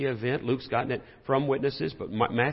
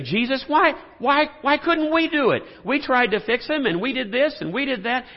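higher-pitched, louder pleading whine; whiny disciples; 10 semitones higher, 3 dB louder than usual. Acted. Disciples whining to Jesus about their failure. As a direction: plead like frustrated kids who failed.

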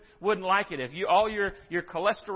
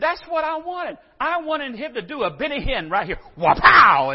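higher, loud petulant shout; mock shout; 9 semitones higher, 13 dB louder than usual. Preacher voicing Naaman's loud mock demand for a showy healing. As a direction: yell it like a spoiled kid demanding fireworks. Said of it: "but he said".

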